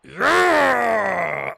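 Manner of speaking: high, loud yell of triumph; victory shout. Real, not acted. Player celebrates the win over Tottenham with a loud shout. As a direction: roar it out.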